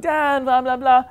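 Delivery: high, loud mock child voice; whiny, pestering kid impression; whining kid. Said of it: "So they'll be like".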